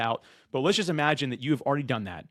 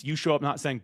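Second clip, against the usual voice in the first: ordinary talk, nothing marked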